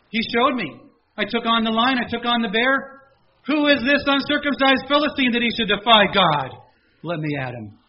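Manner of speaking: loud, high, confident boast; rally like a young warrior; bold defiant David. Retold or imitated. David shouting defiance at the Philistine, loud and bold.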